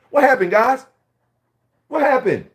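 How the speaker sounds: high, loud mocking shout; exasperated outcry. Real, not acted. Calling out fake vloggers, high exasperated shout. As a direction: call them out in disbelief.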